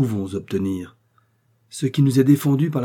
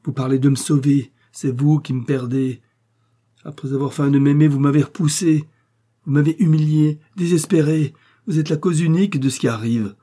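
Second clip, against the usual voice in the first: louder and higher, accusing and hurt; angry reproach; 5 semitones higher, 6 dB louder than usual. A narrator's reading. Rejected young woman accuses Maxime of humiliating her, angry. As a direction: pile up the accusations, rising in anger. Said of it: "Elle quitta ma main avec colère".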